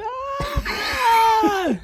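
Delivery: long high shriek while spinning; very high mock wail; imitated scream. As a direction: mimic a sustained high scream. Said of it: "his scream".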